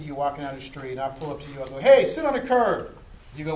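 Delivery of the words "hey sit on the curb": higher, loud barked order to sit; rude officer shout. Retold or imitated. Officer having a bad day yells at a citizen, loud rude order. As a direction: snap the command like a cop in a foul mood.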